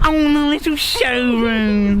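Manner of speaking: high, drawn-out teasing echo; mocking mimicry. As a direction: parrot it back high and slow. Mimics Daisy's remark just made.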